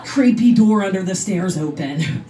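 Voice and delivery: usual speaking voice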